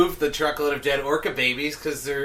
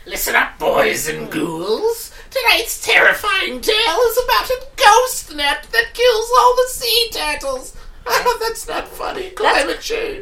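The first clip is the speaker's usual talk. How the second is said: high, loud spooky horror host; cackling Halloween storyteller, hammed up; jack o lantern voice; 6 dB louder than usual.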